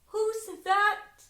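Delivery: high, loud call through the door; high, loud girl calling at the door. Acted. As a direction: ask who knocks, nervous.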